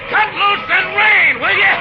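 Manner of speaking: high, loud, slow pleading to the sky; delighted man calling up at the rain; joyful urging. Acted. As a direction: coax the rain down with glee.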